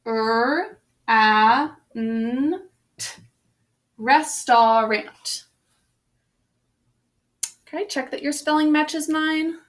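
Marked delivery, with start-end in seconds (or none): 0.0-3.2 "R, A, N, T" as separate held phonemes; phonics-teacher "R, A, N, T" with gaps; single sounds. Shown as a demonstration. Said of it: "Syllable break". 4.0-5.5 very slow "restaurant" as res-tar-ant; teacher's drawn-out "restaurant", every vowel sounded; spelling pronunciation. Shown as a demonstration. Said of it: "let's go over the correct spelling".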